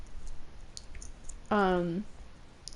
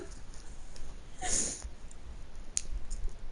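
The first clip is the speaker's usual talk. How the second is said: long breathy laugh; 7 dB quieter than usual. Real, not acted. Laughing after the punchline.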